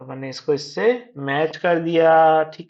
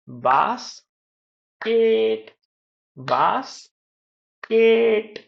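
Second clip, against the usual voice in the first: बास, long pause, higher केट; split into syllables; 6 semitones higher, much slower than usual. Shown as a demonstration. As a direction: break basket into two drawn-out beats. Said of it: "दो syllable भी होते हैं".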